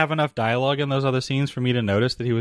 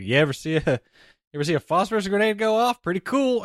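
breezy, higher mock bravado; off-the-cuff cavalier; 7 semitones higher, faster than usual. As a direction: toss it off like it's nothing, in an imitating tone. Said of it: "off-the-cuff cavalier".

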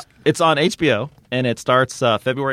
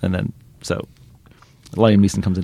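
usual speaking voice

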